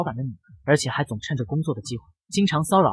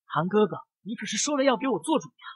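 sulky pout; 5 semitones higher, 4 dB quieter than usual. A narrator's reading. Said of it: "不高兴的嘟着嘴".

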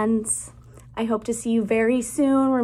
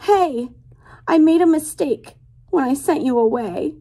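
higher, louder pig calling out sorry; pleading pig voice; 7 semitones higher, 6 dB louder than usual. Acted. Pig in the story calling her friends to swim back, higher pleading call.

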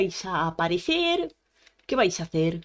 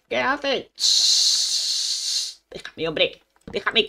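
usual speaking voice

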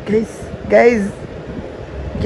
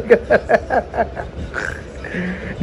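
a long, airy, unvoiced laugh; breathy laugh; 6 dB quieter than usual. Real, not acted. Wife laughing at husband's outfit, airy laugh.

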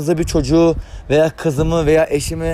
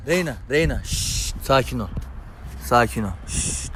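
softer, lower calming words; soothing; 5 semitones lower, 7 dB quieter than usual. Real, not acted. Owner softly soothes Reyna after the scuffle. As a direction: settle her down gently.

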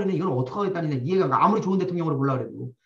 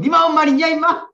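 loud, much higher bratty shout; kid's angry yell; 9 semitones higher, 8 dB louder than usual. Retold or imitated. The other kid shouts back in a childish rage. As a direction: snap back like a scrapping schoolkid. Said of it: "그럼".

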